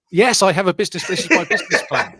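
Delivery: loud, laughing proud claim; excited laughing boast. Pretend. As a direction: show it off with a laugh.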